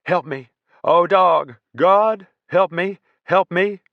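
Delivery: screaming high and loud, pleading; terrified screaming. Acted. Panicked soldier screaming for help in the dark, high and loud. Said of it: "The dog handler was screaming louder".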